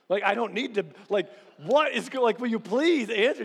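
exasperated wife. Retold or imitated. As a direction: push it up and out like a frustrated spouse. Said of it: "she's like".

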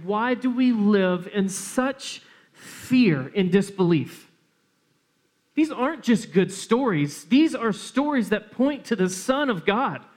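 fervent sermon climax; preaching. Acted. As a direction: build the passion, preach it out. Preaching appeal.

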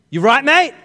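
high, loud, testy challenge; pointed, irritated question thrown out loudly; snapping at someone. Retold or imitated. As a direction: confront the kid blocking your way. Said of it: "did you hear the tone of my voice?".

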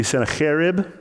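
Sennacherib with a throaty Hebrew "kh"; Hebrew pronunciation. Shown as a demonstration. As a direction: say Sennacherib as senna-KHE-rib, rasping the CH. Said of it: "the actual Hebrew pronunciation would be".

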